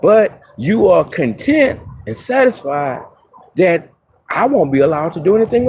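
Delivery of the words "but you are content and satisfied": ordinary delivery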